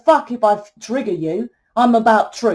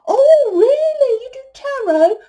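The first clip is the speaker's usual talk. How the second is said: mock amazement; 4 dB louder than usual. Mimicking someone told she does tarot readings, mock amazement. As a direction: imitate a gushing, patronising listener in a piercing high voice.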